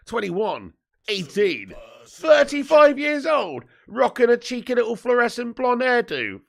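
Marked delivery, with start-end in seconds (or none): 2.1-6.4 incredulous. Real, not acted. Mocking the player's hair.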